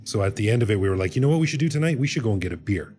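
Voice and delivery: ordinary delivery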